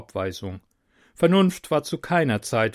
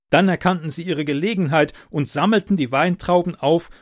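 ordinary delivery

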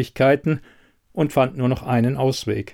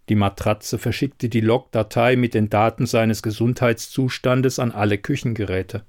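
usual speaking voice

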